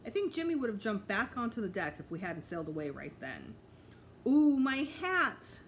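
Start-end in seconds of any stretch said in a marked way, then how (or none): 4.3-5.6 loud, slow drawn-out ooh of dismay; dismayed cry. A narrator's reading. Woman losing her hat to the wind, crying out. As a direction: wail as the hat flies off. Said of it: "Missus Tubman cried".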